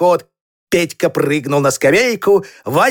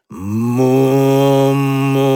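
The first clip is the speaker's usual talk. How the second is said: long, low, steady moo; drawn-out low cow imitation; 6 semitones lower, much slower than usual. A narrator's reading. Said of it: "и мычала".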